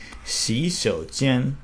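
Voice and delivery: "洗手间" drawn out very slowly; Mandarin tones. Shown as a demonstration. Teacher repeats "洗手间" for learners, very slow. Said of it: "if I say".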